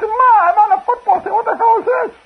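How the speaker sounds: very high, loud mock yell; hammed-up outraged shriek. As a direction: shriek at mom in disbelief. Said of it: "I said".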